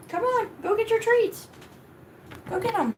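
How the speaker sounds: pet talk. Real, not acted. Urging dog.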